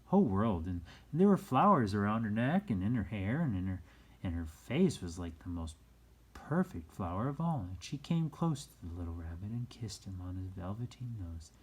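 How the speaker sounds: ordinary delivery, nothing marked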